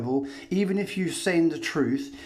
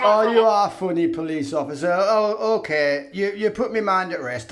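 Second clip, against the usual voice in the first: mock cheery bloke, louder; a lightly voiced chirpy passer-by; voicing Gazer Frackman; 5 semitones higher, 5 dB louder than usual. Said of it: "says Gazer Frackman".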